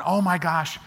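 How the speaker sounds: high, exasperated mock groan; fed-up owner muttering about his cars. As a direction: roll your eyes at it. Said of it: "he's just going".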